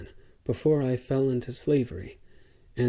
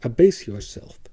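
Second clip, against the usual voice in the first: louder order; stern command; 6 dB louder than usual. A narrator's reading. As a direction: command him down. Dealer commanding the slave.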